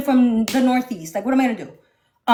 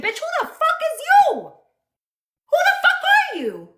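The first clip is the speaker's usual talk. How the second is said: furious shout; 13 semitones higher than usual. Real, not acted. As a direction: scream it at her, mocking and furious. Shouting at Tina on live.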